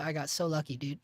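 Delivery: usual speaking voice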